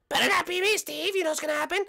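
high falsetto challenge; pushy squeaky contestant; warning contestant. Acted. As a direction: warn him in a put-on girl voice.

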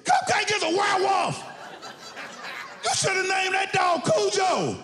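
high-pitched cry of horror; alarmed holler. Acted. Visitor meeting friend's monstrous dog, high alarmed holler. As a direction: holler it high, shocked by the beast. Acts terrified reaction to huge dog.

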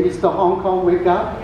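usual speaking voice

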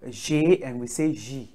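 first J as "zhay", second J as "zhee"; French G and J. Shown as a demonstration. Teacher checking students get it, J and J as French "zhay", "zhee". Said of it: "In French we say".

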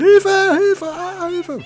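high, mocking falsetto cries for help; parroting the voice calling for help; mock cry for help. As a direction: squeal it like a helpless damsel.

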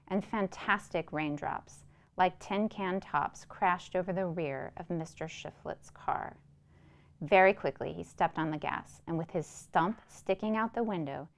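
measured, even storytelling read; story narration. A narrator's reading. Narrator reading a Flannery O'Connor story to listeners, measured storytelling. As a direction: read the tale aloud, steady and unhurried. Reads the short story aloud.

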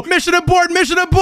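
urgent alarm. Acted. Prankster watching the prank fail, urgent alarm. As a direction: call off the plan in alarm.

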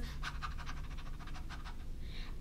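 quick, soft panting like a dog; breathy dog pant. Acted. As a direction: pant with the tongue out. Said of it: "stick out his tongue and pant".

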